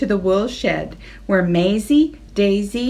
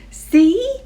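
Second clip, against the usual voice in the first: eager; 11 semitones higher, 5 dB louder than usual. Acted. Henry proud question.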